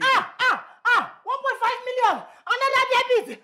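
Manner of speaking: panicked shout. Acted. Wife shouts as another debit hits, panicked yell. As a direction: shout the new amount in panic.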